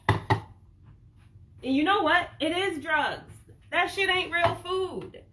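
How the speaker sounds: emphatic outburst. Real, not acted. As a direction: make the point land hard, slowing right down. Rant about junk food as drugs.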